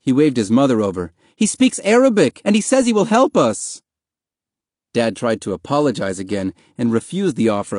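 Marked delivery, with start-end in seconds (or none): 1.4-3.8 high, loud excited call; thrilled. A narrator's reading. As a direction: call your mother over with great news. Thrilled boy calls his mother over.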